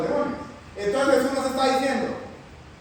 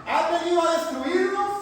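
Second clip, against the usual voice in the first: laughing demon voice; 6 semitones higher than usual. Acted.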